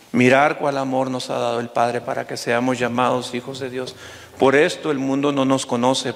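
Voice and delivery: low-pitched, measured pastoral exhortation; gentle preaching. Real, not acted. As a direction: tell them they are loved, low and unhurried. Preacher applies the lesson to congregation. (predicación serena, en tono grave y pausado)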